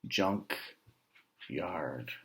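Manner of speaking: plain reading voice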